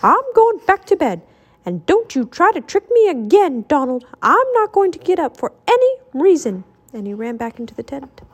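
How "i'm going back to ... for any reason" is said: high, emphatic scolding; indignant high-pitched telling-off; shouted. A narrator's reading. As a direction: refuse crossly with pitch jumping up. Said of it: "Mickey shouted".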